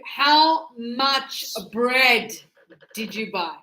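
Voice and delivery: "how much bread" very slow, word by word; slow teacher modelling. Shown as a demonstration. Teacher models the question slowly.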